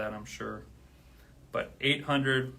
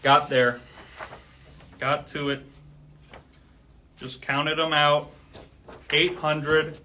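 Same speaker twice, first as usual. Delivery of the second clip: loud victorious calling out; triumphant shout; 3 semitones higher, 9 dB louder, slower than usual. Real, not acted. Streamer hitting eight hundred live, triumphant shout. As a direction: shout it like crossing a finish line.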